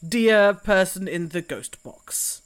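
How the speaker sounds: ordinary talk, nothing marked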